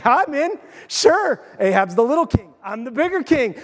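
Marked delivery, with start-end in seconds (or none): 0.0-1.4 high, eager mock agreement; eager yes. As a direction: jump at the offer.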